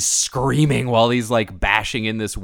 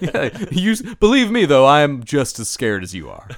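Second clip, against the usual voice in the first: high, loud mock reassurance; mock reassuring; 3 semitones higher, 6 dB louder than usual. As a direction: play the fake calm boyfriend. Voicing Peter mockingly.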